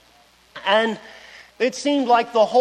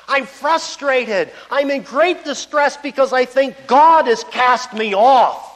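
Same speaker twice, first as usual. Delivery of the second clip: louder, higher, pleading voice of a man in despair; voicing desperate Job; 4 semitones higher, 5 dB louder than usual. Job crying out to his judging friends, louder. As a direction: plead as Job, frazzled and frustrated. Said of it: "He's saying something like this".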